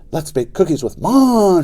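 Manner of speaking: high, slow excited shriek. Acted. Little sister shrieking as she runs off. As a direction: shriek it with delight.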